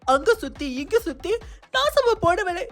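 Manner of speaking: outraged scolding. Acted. Outraged quarrel with sister-in-law.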